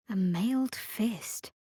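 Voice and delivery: slow, hushed whisper of recognition. Acted. Explorer spotting a sinister emblem, slow whisper. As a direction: breathe it out slowly.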